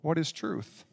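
a soft, dropped repeat; quiet echo. Retold or imitated. Pilate repeating his question quietly to himself. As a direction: let the question sink into a near murmur. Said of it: "so he cries out".

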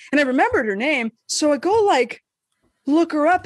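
ordinary talk, nothing marked

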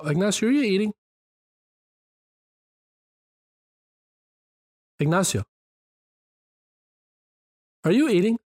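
high, amused disbelief; wait, is he eating right now?; surprised. Real, not acted. Catches cohost eating on air.

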